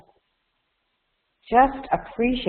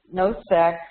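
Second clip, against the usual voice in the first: ordinary talk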